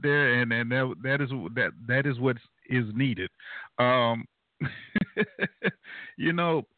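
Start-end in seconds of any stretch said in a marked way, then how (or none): 4.6-6.1 high breathy chuckle; real laugh. Real, not acted. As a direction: let out a run of light chuckles. Amused reaction before resuming.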